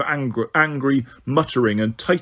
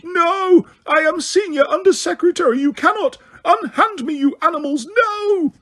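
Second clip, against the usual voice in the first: very high, piercing shriek. A narrator's reading. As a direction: shriek in terror. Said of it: "Umbridge shriek".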